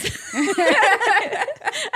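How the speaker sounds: high bright laugh at the memory; burst of laughter. Real, not acted. Sister recalls the anchovies, laughing out loud.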